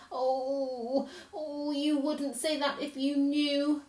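moaning protest through acted sobs; between sobs. A narrator's reading. Faun rejects Lucy's comfort, between his sobs. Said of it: "replied Mister Tumnus between his sobs".

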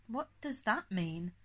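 a touch of confusion; confused. A narrator's reading. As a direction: ask it as if not following.

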